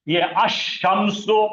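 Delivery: अशम्सो drawn out, doubled श clear; slow Arabic reading. Shown as a demonstration. Teacher to students, slowly modelling अशम्सो. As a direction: read अशम्सो slowly and carefully. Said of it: "क्या पढ़ा जाएगा देखिए".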